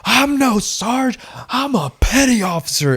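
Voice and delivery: exploding in fury. Retold or imitated. Petty officer correcting a clueless recruit, explosive yell. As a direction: imitate an instructor blowing up. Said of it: "his head just, like, explodes".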